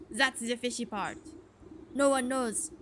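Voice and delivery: suspicious farmer with an attempted French accent; hammy French-accented farmer. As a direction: confide it in a rough French accent. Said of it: "is this a French accent?".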